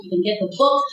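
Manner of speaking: ordinary talk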